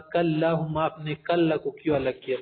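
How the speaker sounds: ordinary talk, nothing marked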